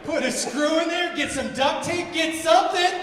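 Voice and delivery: loud, high, laughing protest; hammed-up panic at the mechanic; panicked yelling. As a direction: shout at him through the glass.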